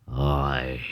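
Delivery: a long, tired sighing Ôi; weary sigh. A narrator's reading. Old father sighs about his useless son, weary. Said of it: "thở dài".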